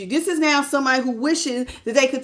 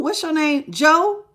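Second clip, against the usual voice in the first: ordinary talk, nothing marked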